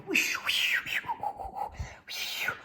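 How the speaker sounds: airy, voiceless whooshes; whooshing ride noise. Acted. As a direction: make rushing swishes with the breath. Imitating a rushing ride on water.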